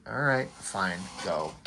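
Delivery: low, soft, grudging surrender; resigned giving in. Retold or imitated. Man lets the woman leave after she refuses help, resigned. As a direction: let it drop away quietly, giving up. Character gives up after being rebuffed.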